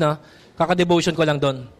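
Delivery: ordinary delivery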